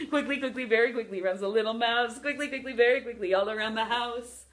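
rapid, rhythmic scurrying patter; fast playful nursery-rhyme chant; quick rhyme. Pretend. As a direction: rattle it off like a scurrying mouse. Performing the quick mouse rhyme.

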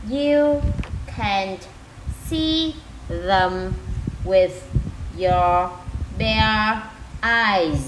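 each word set apart by a pause. Shown as a demonstration. Teacher rereads the sentence for moms, word by word. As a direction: read it out one careful word at a time. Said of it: "chị sẽ đọc lại từng từ ha".

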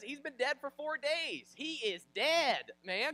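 high, loud, slow insistence; exasperated protest. Retold or imitated. Martha insists Lazarus is dead, exasperated protest. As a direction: spell it out in mock exasperation.

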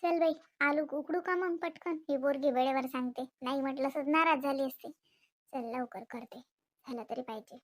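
low, soft grumble; muttering to oneself. Acted. Mother alone deciding to cook quickly, muttering to herself.

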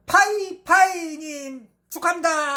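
high, slow congratulations; hyped giveaway announcement. Real, not acted. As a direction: call it out like a prize host. Announcing winner.

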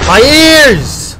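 high yell of pain. Real, not acted. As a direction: cry out as the noise hurts. Loud noise, shouting in pain.

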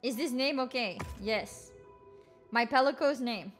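usual speaking voice

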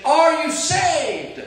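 high, slow, loud call across the water; drowning man shouting over the sea; cried out. Retold or imitated. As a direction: call it out over the roar of the waves. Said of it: "cried out to me over the sound".

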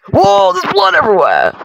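high startled cry, falling at the end; alarmed exclamation. Real, not acted. Gamer sees blood all over the screen, alarmed exclamation.

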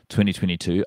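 ordinary delivery

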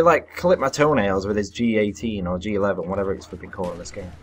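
ordinary talk, nothing marked

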